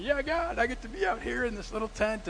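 high, smug inner voice; a pleased, mock-grateful thank-you; smug relief. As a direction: gloat to yourself about the tent. Smug thought in the tent.

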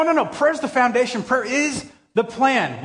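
usual speaking voice

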